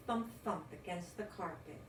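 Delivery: ordinary delivery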